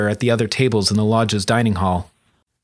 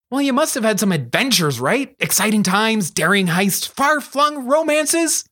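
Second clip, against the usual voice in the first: put-on high girlish voice, building to a higher, drawn-out finish; Charlie, hopeful; 9 semitones higher than usual. Girl fishing for adventure tales from her friend, high and eager. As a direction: list the thrills with mounting eagerness.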